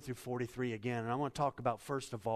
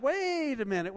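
startled crowd; 9 semitones higher than usual. Retold or imitated. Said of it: "And they're like".

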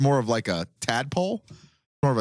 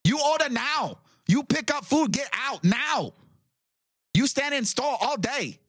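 high, clipped broken-English shopkeeper voice, much higher; 12 semitones higher, slower than usual. Retold or imitated.

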